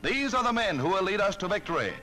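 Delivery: usual speaking voice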